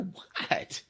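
breathy hushed whisper; whispered what. Retold or imitated. Elbowed by his crewmate at the bar, he whispers back. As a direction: whisper it so nobody hears. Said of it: "I go".

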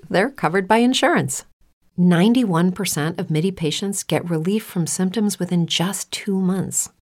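normal talking voice

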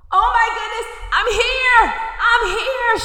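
high, loud shout to be heard; screaming call. Acted. Girl screaming to the people on the mountain. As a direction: yell out across the mountain. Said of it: "In that moment of her screaming".

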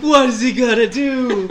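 loud, laughing, voiced as the stunned friend; laughing friend quote. Teller laughing, voicing his stunned friend below. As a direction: laugh through the friend's panic. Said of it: "He's like".

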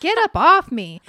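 loud, high mock protest; mock irritated outburst. Woman fending off a clingy boyfriend, acted loud and irritated. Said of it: "And I'm just like".

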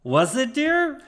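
high, rising voice of the wife; Australian wife. Acted. Wife answering her husband at the barbecue, high wifely voice. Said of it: "we have the wife coming out".

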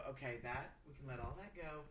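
hushed mellow relief; calm letting go. Retold or imitated. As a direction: ease off and let it all go. Voicing calm after weed.

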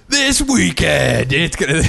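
slow, dragged-out promo announcer; a light imitation of a wrestling hype man. Retelling the prank call as a slow promo announcer. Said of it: "it sounds like the wrestling promo guy".

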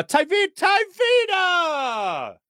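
high, drawn-out shout; exuberant call-out. Real, not acted. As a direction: holler the name, stretching the last one long. Calls out a name, drawn out.

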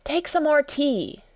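high-pitched, earnest urging. A narrator's reading. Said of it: "said to Alice very earnestly".

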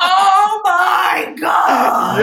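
loud, high, drawn-out laughing cry; remembering the stormtroopers, a laughing shriek; shrieking excitement. Retold or imitated. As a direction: squeal it out in delight. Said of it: "And I was like".

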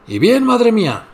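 surly reply. Acted. Rude daughter answering her mother, sneering. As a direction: drawl it back with contempt.

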